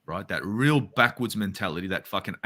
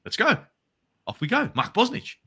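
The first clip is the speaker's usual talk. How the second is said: much higher, bubbling with eagerness; childlike excitement at meeting a famous keeper; eager fan; 12 semitones higher than usual. Retold or imitated. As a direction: sound like a kid off to meet his idol. Eager to meet hero.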